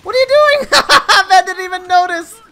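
high, loud laughing outburst; shouting in shocked laughter; loud disbelief. Real, not acted. Teammate blunder, laughing disbelief.

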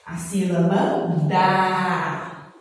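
slow, with a long held "dá"; drawn-out syllable. Shown as a demonstration. Teacher answering for young pupils, drawn-out syllable. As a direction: stretch "dá" out at length for learners.

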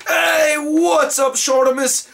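high, drawn-out British greeting; British accent. Acted. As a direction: call out a hello in an amateur British accent. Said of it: "do this in the British accent".

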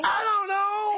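very high-pitched exasperated squeal; exasperated wail. Real, not acted. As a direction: throw it up high in helpless exasperation. Co-host challenges the confusing naming.

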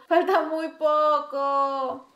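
drawn-out hopeful plea; drawn-out strain. Real, not acted. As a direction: stretch each word out, pleading.

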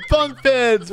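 high-pitched delighted whoop; acted childlike squeal of joy; excited kid. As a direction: exclaim like a kid thrilled by a surprise. Acting the child seeing bunk beds.

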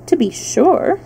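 offhand hen voice; casual, inattentive reply; careless. A narrator's reading. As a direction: toss it off without caring. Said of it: "said Billina in a careless tone".

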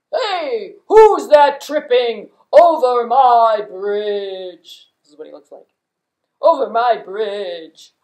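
very loud, slow bellowing troll. Acted. Troll catching goat on bridge, loud slow bellowing troll. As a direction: roar the challenge like a bridge troll. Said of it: "this big old troll".

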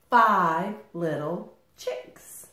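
usual speaking voice

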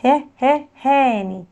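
"ها، ها" in short beats, then "هاني"; phonics drill. Shown as a demonstration. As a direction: sound out H twice before "هاني". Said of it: "كلمات على حرف ال H". (تدريب صوتي: "ها، ها" منفصلة ثم "هاني")

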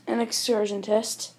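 ordinary delivery

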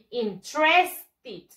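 interested slowly as "in-TRES-tid"; slow model "in-TRES-tid" for repetition; correct pronunciation. Shown as a demonstration. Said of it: "Repeat after me".

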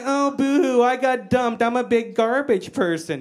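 high-pitched mock whining; mocking boo-hoo. Niece mocking his self-pity.

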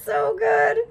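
excited. Real, not acted. Still laughing, then asking forgiveness.

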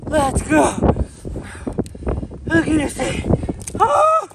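loud, pumped-up shouts; excited yelling. Real, not acted. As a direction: yell it out, hyped. Yelling at his catch.

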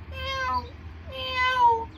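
high, held meow; cat meow. Acted. Voices the cat in story.

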